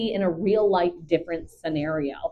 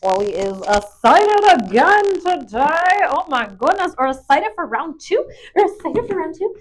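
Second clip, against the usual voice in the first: high, loud excited cooing; excited dog talk; 10 semitones higher, 7 dB louder than usual. Pretend. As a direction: squeal it playfully to an excited dog.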